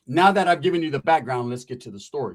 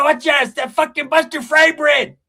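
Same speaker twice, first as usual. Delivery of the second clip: high, loud, slow yelled warning; mock shout; 11 semitones higher, 7 dB louder than usual. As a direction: bellow it across the yard.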